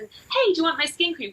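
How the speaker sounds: bright, high, loud sales pitch greeting; pushy seller. Pretend. Seller accosts stranger with skin cream, high loud chirpy pitch.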